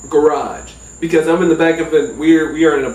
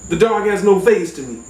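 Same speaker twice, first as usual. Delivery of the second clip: ordinary delivery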